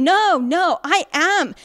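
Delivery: loud, high indignant protest; a woman yelling in public denial; retold scream. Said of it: "she's just screaming and saying".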